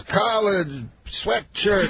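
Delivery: very slow, high comic impression; Bill Cosby. Veteran comedian explaining his clothes, imitated in slow single words. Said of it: "He talks, like, just in words".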